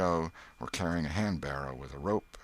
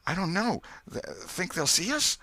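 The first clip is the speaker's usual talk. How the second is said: panicky; 13 semitones higher, 4 dB louder than usual. Acted. Terrified boys hiding in graveyard.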